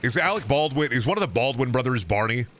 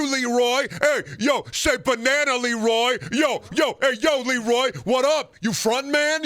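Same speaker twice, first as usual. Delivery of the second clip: mock street tough; 11 semitones higher than usual. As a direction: jeer like a schoolyard bully. Taunting Leroy in a put-on voice.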